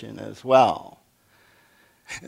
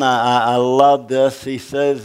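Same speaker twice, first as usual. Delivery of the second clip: ordinary talk, nothing marked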